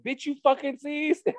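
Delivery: very high-pitched retold accusation; squeaky mock outrage; mock confrontation. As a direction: imitate an outraged challenge, voice way up. Retold confrontation, teller cracking up.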